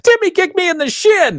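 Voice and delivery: put-on high whiny little kid; child voice. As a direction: whine like a tattling child. Voicing whining child.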